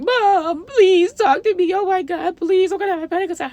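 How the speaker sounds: high, loud mock bawling; hammed-up hysterical plea to mom; wailing panic imitation. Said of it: "this is how she sounded".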